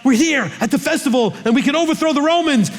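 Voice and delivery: high, excited voice; eager Judas. Retold or imitated. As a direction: pitch it up like a zealot sure of the revolt. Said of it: "'Cause Judas is like".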